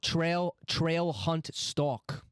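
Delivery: very slow, stretched out words; drawn out. Real, not acted. Trying word guesses, stretching each one slowly.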